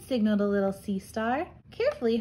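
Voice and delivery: ordinary delivery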